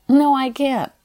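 high, loud insistent no; vigorous refusal. Retold or imitated. Client firmly refusing her therapist, high and loud. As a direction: refuse it flat out. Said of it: "I vigorously replied".

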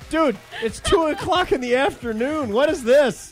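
high-pitched mock disbelief; incredulous protest. Retelling shock at afternoon stripper.

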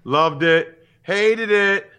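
loud, high mock critic verdict; hammed-up In Living Color catchphrase; sketch catchphrase. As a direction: snap it out like a TV critic. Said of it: "In Living Color back in the nineties".

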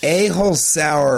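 drawn out, savoring each word; slow, relishing delivery; slow deliberate phrase. Shown as a demonstration. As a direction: say it slowly like a catchphrase. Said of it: "the best way to add on to a-hole is to say asshole".

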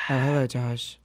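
put-on low, affected English accent; understated mock-English hello. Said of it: "That's how she talks".

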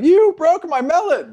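put-on high, slow, outraged old woman; angry melon seller. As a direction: wail it like a shopkeeper robbed. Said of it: "there's a woman that's like".

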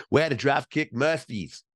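a bad Boston accent, pitched a bit up; terrible Boston accent. Host mimics Ben Affleck in a terrible Boston accent. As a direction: drop the R's like a bad Boston impression. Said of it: "That's my terrible Boston accent".

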